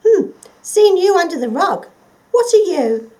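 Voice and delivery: high, loud squeaky little mouse voice. Acted. Mouse warning the forgotten creature, high and squeaky. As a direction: pipe it up like a busy little mouse.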